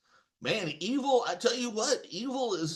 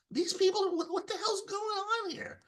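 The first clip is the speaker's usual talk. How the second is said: much higher, baffled voice at usual volume; high-pitched disbelief. Retold or imitated. Host retelling finding politics in the ancestral town, high-pitched disbelief. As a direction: retell your bewilderment, pitched way up.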